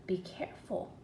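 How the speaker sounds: low whispered caution; hushed warning. Retold or imitated. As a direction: breathe it out like a secret. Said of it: "So he's telling her".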